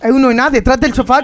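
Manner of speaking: loud urgent callout; shouting orders over battle. Real, not acted. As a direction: yell the position out. Aviso de enemigo en partida. (aviso gritado en combate)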